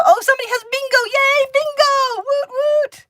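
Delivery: loud, very high whooping celebration; excited cheering. Real, not acted. Host spots a viewer's bingo, excited cheering. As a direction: squeal and whoop for the winner.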